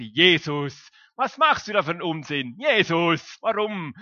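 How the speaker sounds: loud scolding father; a loud mock telling-off. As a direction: scold him loudly like an angry parent. God scolding Jesus, imagined.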